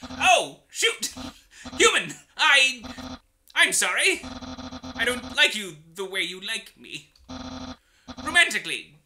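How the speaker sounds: loud, high, boastful skeleton chef; theatrical bragging skeleton shouting; Papyrus voice. Acted. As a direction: boom it out like a proud cartoon skeleton.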